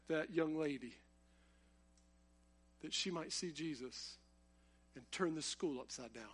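soft, hushed prayer for the campus; quiet prayer. Acted. Preacher praying for revival on his campus, hushed prayer. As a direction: pray it low, almost to yourself.

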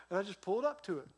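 normal talking voice

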